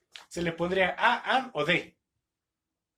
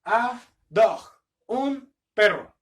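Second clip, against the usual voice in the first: teacher calls out the answer, louder and higher; loud, emphatic model answer; 3 semitones higher, 9 dB louder, slower than usual. Shown as a demonstration. Teacher calling out the answer to the class, loud. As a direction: announce it to the class with a punch. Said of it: "teacher models the answer".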